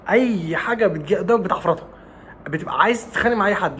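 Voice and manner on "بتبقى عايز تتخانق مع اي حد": ordinary talk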